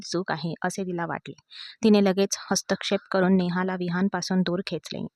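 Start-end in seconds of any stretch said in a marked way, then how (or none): none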